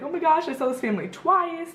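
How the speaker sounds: high-pitched thrilled exclamation; retold burst of delight; happy amazement. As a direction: gush over a lucky coincidence. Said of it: "so happy".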